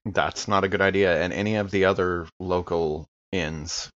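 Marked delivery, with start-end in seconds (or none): none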